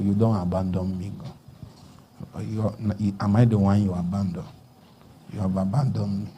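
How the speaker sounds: low, hushed lament; weary plea. Acted. Worshipper to God after weeks of crying, a weary low plea. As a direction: plead softly and low, worn out from crying.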